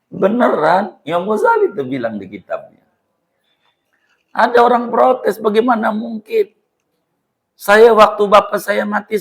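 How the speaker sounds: high, loud, insistent sermon delivery; rising to full force, challenging the listeners; fervent preaching. Acted. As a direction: preach it hard, pressing every question on the crowd. Preacher rouses the congregation.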